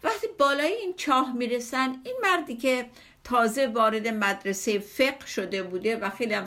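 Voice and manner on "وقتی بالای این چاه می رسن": normal talking voice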